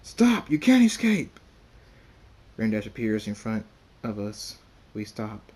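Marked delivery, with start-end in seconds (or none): 0.0-1.4 high, loud pursuers' yell; urgent high-pitched shouting; screamed threat. A narrator's reading. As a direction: scream after the runaways. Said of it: "They scream".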